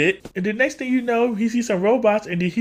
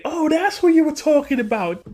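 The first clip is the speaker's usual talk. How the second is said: imitating Sonic; 12 semitones higher than usual. Sonic finally gets who Eggman is, high and dawning. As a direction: pitch way up as it clicks. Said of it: "Sonic finally then goes on to say".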